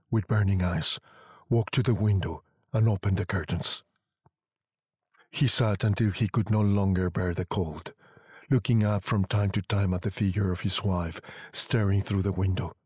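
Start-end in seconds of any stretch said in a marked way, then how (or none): none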